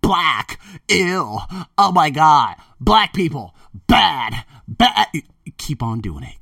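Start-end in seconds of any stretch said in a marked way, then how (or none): 0.0-5.1 mocking bigots. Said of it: "those same people that say".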